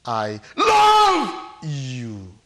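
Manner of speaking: huge drawn-out LOVE in the middle; stress on LOVE. Shown as a demonstration. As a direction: belt out the word "love", slowly. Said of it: "The emphasis is where?".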